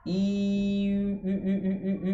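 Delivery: long high I sliding into puckered U; I turning into French U. Shown as a demonstration. Teacher demonstrating tongue of I with lips of U. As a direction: hold I, then pout into repeated U. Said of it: "lá dentro eu vou continuar fazendo a letra I".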